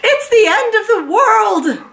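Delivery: high, loud mock panic with a laugh; panicked. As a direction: wail it like the world is ending. Mrs Watson panicking.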